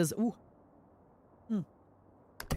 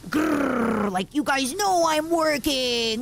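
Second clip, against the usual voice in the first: mock grumpy growl; 7 semitones higher, much slower than usual. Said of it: "I actually got a little bit like".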